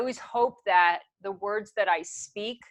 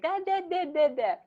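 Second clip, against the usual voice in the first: put-on high-pitched babbling baby; baby talk; 7 semitones higher, faster than usual. Baby trying first sounds, high babbling. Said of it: "you started to make baby talk".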